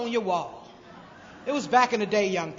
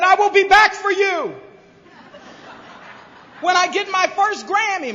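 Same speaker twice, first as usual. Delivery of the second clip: grand promise; 9 semitones higher, 11 dB louder than usual. Retold or imitated. Grand promise to her mother.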